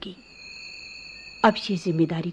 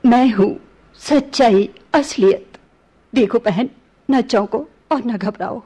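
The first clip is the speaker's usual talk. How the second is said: bold reveal; 2 semitones higher, 7 dB louder than usual. Acted. Princess reveals her identity.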